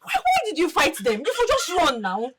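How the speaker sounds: high-pitched, alarmed reproach; distraught scolding. Acted. Sister scolds brother who was nearly killed, distraught. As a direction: wail at him in fright for his life.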